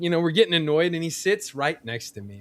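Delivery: normal talking voice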